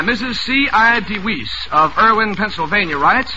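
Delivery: usual speaking voice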